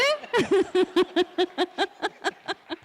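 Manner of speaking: long high-pitched laugh; real peal of staccato laughter. Real, not acted. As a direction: laugh heartily at yourself. Laughs at the teacher's retort.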